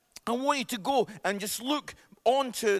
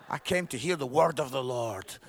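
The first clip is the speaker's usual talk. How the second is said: low, mock-solemn churchgoer; disapproving ladies; 10 semitones lower than usual. Preacher imitates ladies wanting the word of the Lord, low mock disapproval. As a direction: imitate an unimpressed lady, pitched low. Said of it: "Those ladies are like".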